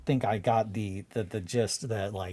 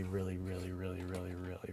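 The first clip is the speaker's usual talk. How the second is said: fading repeat; 4 semitones lower, 12 dB quieter, faster than usual. Real, not acted. Repeating really, fading away.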